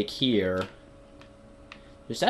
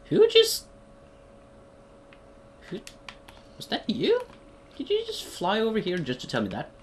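startled; 10 semitones higher than usual. Real, not acted. Startled by something flying over.

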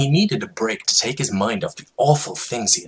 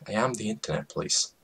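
quick aside; 11 dB quieter, faster than usual. Acted.

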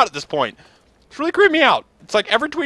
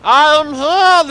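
mock announcer; 3 semitones higher, much slower than usual. Announcing his arrival to the crowd, slow pompous announcer voice. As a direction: announce your own arrival like a ring announcer.

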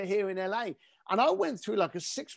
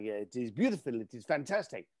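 put-on low, soft, unimpressed Italian accent; a bored Italian curator, lightly put-on accent; 7 semitones lower, 6 dB quieter than usual. Curator brushing off the host, in a mild mock Italian accent. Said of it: "Apologize about the accent".

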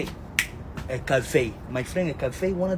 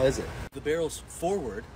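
ordinary talk

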